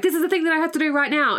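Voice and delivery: fast, high, manic rush. Retold or imitated. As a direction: blurt it out manically. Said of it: "she's like".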